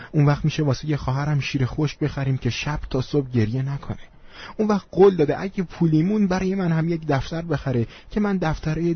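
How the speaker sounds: put-on high, pleading child voice; schoolboy holding back tears. A narrator's reading. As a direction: explain yourself to the teacher like a little boy. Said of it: "بغضش رو به زحمت قورت داد و آروم گفت".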